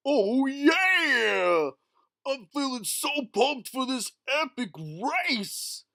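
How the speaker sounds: high, pumped cartoon voice; Goofy voice. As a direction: gush with goofy excitement.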